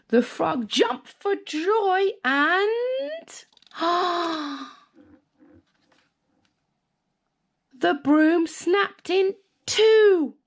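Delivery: gasping, hushed then high; breathless fright. A narrator's reading. Narrator telling the broom snapping, gasping fright. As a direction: gasp as the broom breaks. Broom snaps mid-flight.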